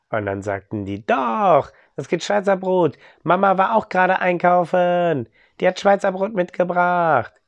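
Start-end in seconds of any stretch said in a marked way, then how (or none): 1.1-7.3 put-on high insistent child voice; eager children. Children contradict their father: high, louder child voices. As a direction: kids triumphantly correcting their dad, pitched high.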